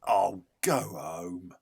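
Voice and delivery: slow, groaning defeated complaint; weary groan. Real, not acted. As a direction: moan it out, fed up. Losing again in the game.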